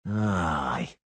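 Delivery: slow drawn-out aye; drawled aye. A narrator's reading. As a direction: drag the word out. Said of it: "Levesque drawled".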